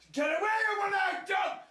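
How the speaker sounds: high, loud shout at the dog; frantic high shout. Retold or imitated. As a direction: drive the dog away with a yell. Fending off a snapping dog.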